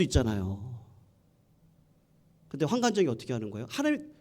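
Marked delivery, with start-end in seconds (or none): none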